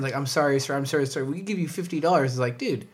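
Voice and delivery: ordinary talk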